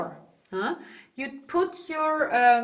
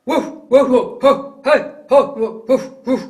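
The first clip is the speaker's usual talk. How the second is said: loud, short chesty woofs; punchy barks in chest voice; big dog bark; 10 dB louder than usual. Shown as a demonstration. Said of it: "Like a big dog".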